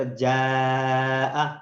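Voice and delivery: جاء with the final vowel held long and steady; long madd vowel. Shown as a demonstration. Quran teacher to students, drawing out جاء slowly. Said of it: "تین second تک میں نے آواز تقریباً جاری رکھنی ہے".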